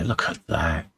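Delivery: ordinary talk, nothing marked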